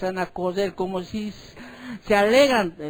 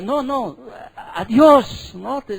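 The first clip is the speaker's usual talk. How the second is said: usual speaking voice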